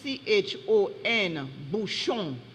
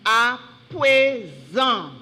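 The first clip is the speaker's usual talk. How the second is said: À PRÉSENT slow and loud, syllables apart; careful dictation; 7 dB louder than usual. Shown as a demonstration. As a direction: repeat "À présent" loudly, drawing out each syllable. Repeat the word again.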